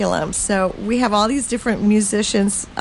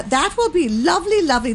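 higher, gushing granny voice; Mother Goose voice; 6 semitones higher, faster than usual. Pretend. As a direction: coo it like a storybook granny. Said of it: "you have to do it in Mother Goose's voice".

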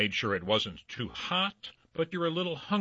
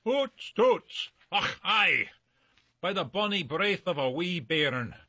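hammed-up Scottish brogue, higher; broad Scottish accent; 5 semitones higher than usual. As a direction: ham up a thick Scottish burr. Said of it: "help me out here".

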